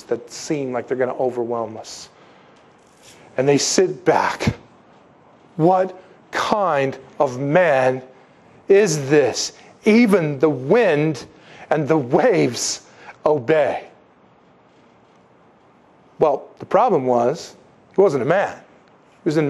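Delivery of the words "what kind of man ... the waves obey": very slow, awestruck; slow, hushed amazement; awed wonder. Retold or imitated. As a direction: let each phrase hang in amazement. Disciples marvel after the calm.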